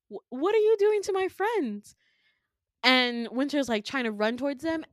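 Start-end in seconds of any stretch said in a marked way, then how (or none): 0.1-1.9 high, stammered alarm; distressed protest. Retold or imitated. Winter seeing her friends in pain, distressed protest.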